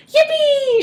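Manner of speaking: very high, loud whoop; shouted yippee. A narrator's reading. Grandpa whoops with joy, very high and loud. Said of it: "shouted Grandpa Joe".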